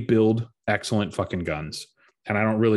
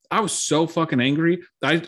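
ordinary talk, nothing marked

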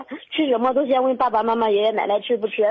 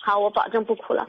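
tearful; 3 semitones lower, 3 dB quieter than usual. Real, not acted. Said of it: "你在节目里边哭给谁听呢".

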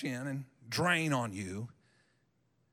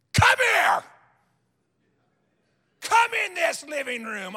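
high, loud challenging yell; furious shout at the devil; 10 semitones higher, 6 dB louder than usual. Retold or imitated. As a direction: roar at him to come in and fight. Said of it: "I have been so mad at the devil".

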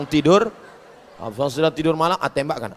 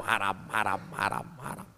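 fading whisper; 8 semitones lower, 16 dB quieter than usual. Acted. Fading repetition.

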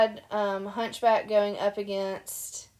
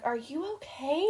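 concerned guy; 6 semitones higher, 4 dB quieter than usual. Retold or imitated. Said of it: "He's like".

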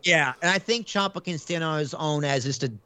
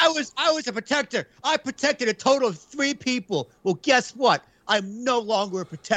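high, mocking heel promo voice, pitched up; mocking Xia Li; 8 semitones higher than usual. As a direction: lampoon her as a whiny villain. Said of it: "She's like".

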